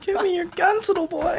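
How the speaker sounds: put-on very high, soft, creepy old man; Herbert impression. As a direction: coo it like a creepy old neighbor. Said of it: "like Herbert".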